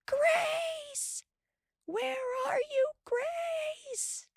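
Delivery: high faint calls; calling from far away; distant calling. A narrator's reading. Pa and Ma's shouts from the slough, faint calling.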